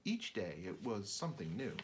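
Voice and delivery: ordinary delivery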